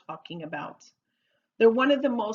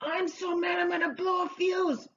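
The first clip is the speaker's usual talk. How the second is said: hammed-up, higher-pitched fuming; mock angry; 8 semitones higher than usual. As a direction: act out being about to blow up. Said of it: "if you hear someone saying".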